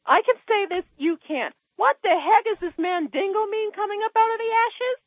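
incredulous rant pitched far above normal; real exasperation, high in the voice; upset, high-pitched. Real, not acted. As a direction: voice shooting way up in indignation. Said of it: "never heard your voice go up that high".

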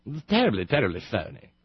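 mock British accent. Host mocking a radio sub, phony British accent. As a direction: lay the fake British on thick. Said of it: "With a British accent is about as phony".

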